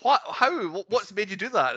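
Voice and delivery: high-pitched baffled questioning; puzzled disbelief, retold; retold bafflement. Retells baffled reaction to the film.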